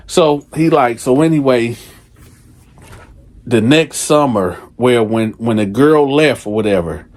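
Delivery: ordinary delivery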